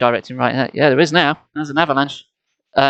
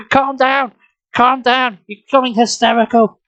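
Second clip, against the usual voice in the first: shouting; 8 semitones higher, slower than usual. A narrator's reading. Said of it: "Try to stay calm," I told her".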